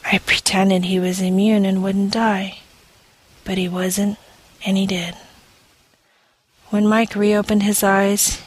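ordinary delivery

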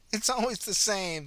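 put-on high, slow amused old lady; a little cackle in the old voice; cackling old woman. A narrator's reading. Old woman cackling over the dazed officer, high and amused. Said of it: "the old woman cackled".

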